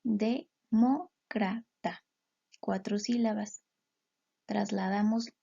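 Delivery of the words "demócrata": "Demócrata" said very slowly, syllable by syllable; slow it down to count de-mó-cra-ta; teacher counting syllables of "Demócrata"; split into syllables. Shown as a demonstration. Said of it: "Hacemos lo mismo".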